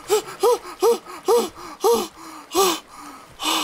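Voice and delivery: panting after the chase; real breathless panting; out of breath. Real, not acted. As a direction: gasp for air in relief. Catching breath after escape.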